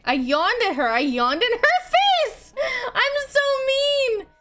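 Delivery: laughing wail climbing very high; mortified squeal. Real, not acted. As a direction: build into a high, loud, guilty squeal. Mortified at yawning at game character.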